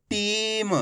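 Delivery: टीम with a long ई, stopping on a half म; half final letter. Shown as a demonstration. Teacher showing students how the joined word is read, half final letter. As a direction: say टीम slowly, clipping the final vowel off म. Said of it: "उच्चारण आपको आधा करना है".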